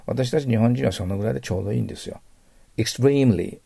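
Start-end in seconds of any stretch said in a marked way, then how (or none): 2.8-3.6 Extremely with an exaggerated stress on "TREE"; 極端なアクセント. Shown as a demonstration. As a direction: lean hard on the middle syllable of Extremely. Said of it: "アクセントは私ちょっと極端に言いますから". (「Extremely」の第二音節を大げさに強く)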